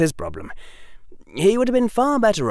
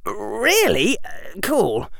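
put-on higher-pitched boy, mildly preoccupied; a bit distracted; 10 semitones higher, slower than usual. A narrator's reading. Harry half-listens to Hermione's news, a bit distracted. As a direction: answer with half your attention elsewhere. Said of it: "sounding a bit distracted".

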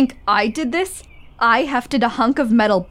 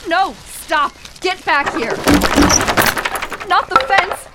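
urgent calls, rising higher; calling after it, higher and higher; urgent commands; 7 semitones higher than usual. Acted. Ordering a runaway object.

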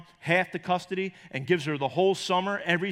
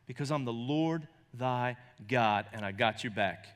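voice of God; 6 semitones lower, 5 dB quieter than usual. Acted. God reassuring the doubting believer, low and calm. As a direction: answer as a calm, sure God.

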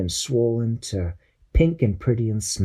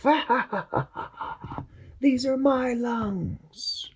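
high cackle fading to a slow whisper; germ's evil laugh; 4 dB quieter, slower than usual. Acted. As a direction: gloat like a sneaky villain. Germ gloats.

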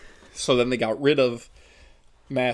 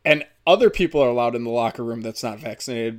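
ordinary talk, nothing marked

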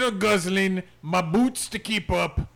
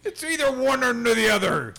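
mock indignant; 7 semitones higher than usual. Mock scolding pet, laughing.

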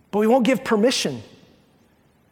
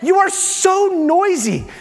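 much higher, slow, drawn-out complaint; exasperated wife; 10 semitones higher, much slower than usual. Retold or imitated. Husband mimics wife scolding his noisy chewing, high and slow. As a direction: stretch it out like a fed-up wife. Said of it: "she's like".

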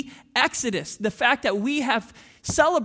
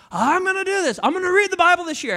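high, loud eager promise; eager resolution; 7 semitones higher, 6 dB louder, faster than usual. Retold or imitated. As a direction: sound pumped up.

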